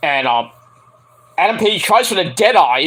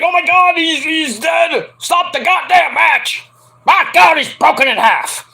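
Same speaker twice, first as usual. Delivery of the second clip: mock panic; 8 semitones higher than usual. Tony Khan panicking and begging to stop the match. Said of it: "Tony Khan's like".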